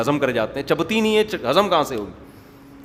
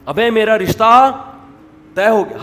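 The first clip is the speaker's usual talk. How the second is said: high, loud and drawn-out, bursting with news; excited announcement; 5 semitones higher, 9 dB louder, slower than usual. Retold or imitated. Young man telling friend about engagement, bursting with excitement. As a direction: stretch it out like big news you can't hold in. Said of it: "کہہ رہے ہوتے ہیں خوشی سے".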